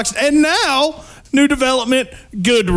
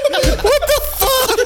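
laughing; 12 semitones higher, slower than usual. Real, not acted. Laughing disbelief.

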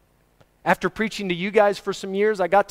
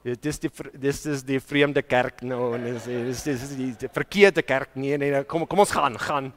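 quieter, lower grumble in Afrikaans; muttering churchgoer; 4 semitones lower, 3 dB quieter than usual. Retold or imitated. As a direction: mutter it as if leaving church in alarm. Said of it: "some of you go".

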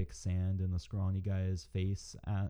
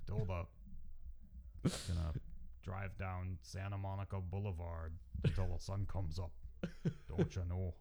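put-on Minnesotan accent; 7 dB quieter than usual. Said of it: "thick Minnesotan accent".

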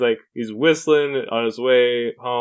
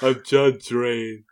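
slow, drawn-out put-on cop voice; much slower than usual. Hosts at the table, trying a slow put-on cop voice. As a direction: drag out the name like a tough guy. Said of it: "Why are you doing that voice?".

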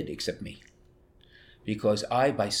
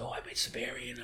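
a quiet whisper; 10 dB quieter than usual. Retold or imitated. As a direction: tell him almost under your breath. Telling father his school choice.

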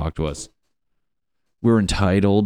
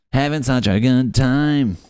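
sarcastic cheer; 5 semitones higher, 4 dB louder than usual. Acted. Mock cheery line repeated.

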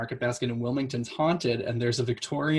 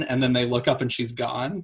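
ordinary talk, nothing marked

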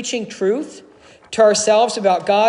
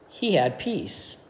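peaceful; 6 semitones lower, 9 dB quieter, slower than usual. A narrator's reading. Peaceful resolution of story.

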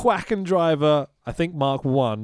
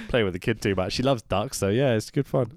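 normal talking voice

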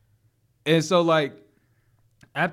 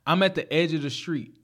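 usual speaking voice

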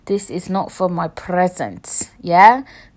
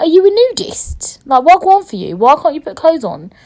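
incredulous; 9 semitones higher, 8 dB louder than usual. Retold or imitated. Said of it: "I was just like".

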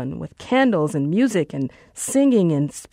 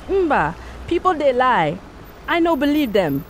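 Nigerian Pidgin shout; 7 semitones higher than usual. Acted. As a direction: shout it in a thick Nigerian accent. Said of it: "he shouted".

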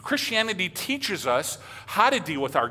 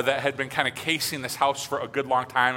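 usual speaking voice

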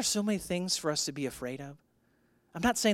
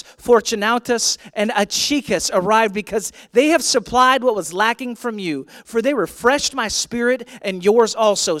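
loud, raised, declaiming preacher voice; forceful scripture reading; 5 semitones higher, 9 dB louder than usual. Real, not acted. Pastor reading Paul's letter to the church, forceful declaiming. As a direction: read it out boldly to a full church. Reads scripture aloud to congregation.

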